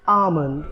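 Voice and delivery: almond said slowly with a silent l; correct pronunciation. Shown as a demonstration. Teacher giving learners the correct pronunciation, silent-l almond. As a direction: say almond as "aa-mund", no l. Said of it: "So the correct pronunciation".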